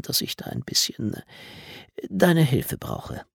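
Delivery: slow, halting admission; hesitant confiding. A narrator's reading. Coach leans in to ask the boy for help, hesitant confiding. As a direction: feel your way toward asking a favour.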